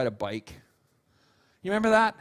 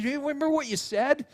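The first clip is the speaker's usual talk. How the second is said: ordinary talk, nothing marked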